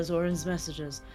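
usual speaking voice

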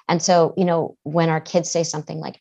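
normal talking voice

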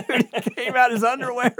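high, laughing through the words. Retold or imitated. Telling friend the dad appeared.

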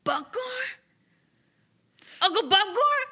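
high, welling-up cry building to loud; tearful. Acted. Player voices Boudreaux finding his caged uncle, tearful. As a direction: call out through tears, pitched high. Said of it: "so he starts crying. It starts welling up".

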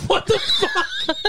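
shouting through a laugh; high, slow, laughing outburst. Real, not acted. Shocked laugh at insult.

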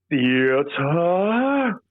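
drawn-out lofty «Theater» as «ee-uh-tuh»; snooty voice. Pretend. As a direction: stretch «Theater» out like a snob at the opera. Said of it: "in the most snooty possible voice".

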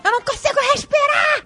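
high, laughing mock scream; half-laughing cry of not breathing; panicked cry. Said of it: "começou a gritar". (grito de pânico meio rindo)

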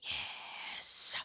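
breathy whisper, barely there; whispered yes. Acted. Host whispering agreement to the letter. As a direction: whisper it like a secret.

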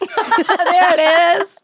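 drawn-out, high, through laughter; amused laughing. Real, not acted. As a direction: laugh as you call it out. Laughing at partner's foreigner excuse.

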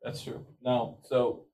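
ordinary delivery

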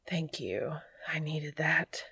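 soft breathless whisper after climax; out of breath. Acted. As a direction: whisper it, still catching your breath. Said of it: "still out of breath".

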